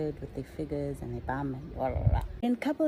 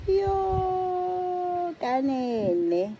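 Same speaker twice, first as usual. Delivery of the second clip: shocked exclamation; 9 semitones higher, 6 dB louder, much slower than usual. Retold or imitated. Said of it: "I'm like".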